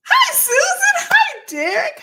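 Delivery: high, loud happy greeting; excited shout. Real, not acted. Greeting named viewers.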